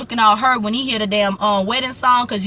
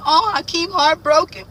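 higher, slow, hammed-up sympathy; mock pity; 6 semitones higher than usual. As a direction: coo over poor heartbroken Hakeem. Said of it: "I was like".

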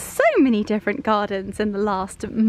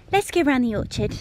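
usual speaking voice

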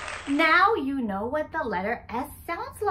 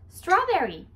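ordinary delivery

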